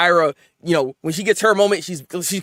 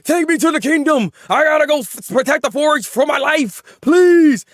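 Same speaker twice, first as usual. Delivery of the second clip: high, louder begging soldier voice; desperate plea; 8 semitones higher, 4 dB louder than usual. Retold or imitated. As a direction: beg to be taken to the kingdom.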